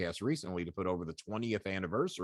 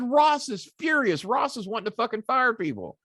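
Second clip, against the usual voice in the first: high, loud, exasperated demand; imitating a furious colleague's phone call; angry Cornette; 14 semitones higher, 6 dB louder than usual. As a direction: bark it like a furious boss on the phone. Cornette voiced warning.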